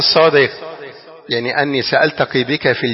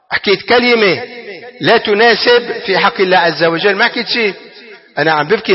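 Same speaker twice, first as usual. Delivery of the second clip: raised, emphatic preacher's voice; impassioned preaching; 6 semitones higher, 4 dB louder than usual. Acted. Preacher to the congregation, explaining the Prophet's answer, raised and fervent. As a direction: drive the point home to the crowd.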